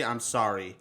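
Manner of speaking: ordinary talk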